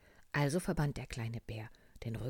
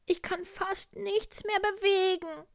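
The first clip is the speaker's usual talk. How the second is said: sick little tiger; 8 dB louder than usual. Acted. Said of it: "rief der kleine Tiger".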